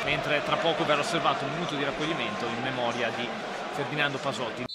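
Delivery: low and hushed for a memorial; solemn hush. Real, not acted. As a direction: drop the voice respectfully. Minute of silence announcement.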